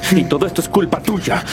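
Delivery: high-pitched, rising outburst of blame; heated, accusing cry; angry accusation. Acted. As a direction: throw the blame at them, pitch climbing sharply. Accusing his brothers in a quarrel.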